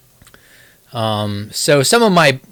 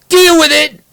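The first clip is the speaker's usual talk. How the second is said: high, loud burst of defiance; defiant shout; 13 semitones higher, 8 dB louder than usual. Pretend. Streamer defends his pronunciation of Shalomance to chat, defiant shout. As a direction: belt it out like a playful challenge.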